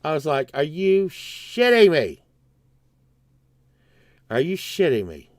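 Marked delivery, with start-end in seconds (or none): 0.5-2.2 higher, slow, stretched swearing; incredulous outburst. Retold or imitated. Customer finding his donut shop closed, incredulous outburst. 4.3-5.3 lower, deflated repeat; subdued disbelief. Retold or imitated. Customer absorbing the donut shop's closing, subdued disbelief.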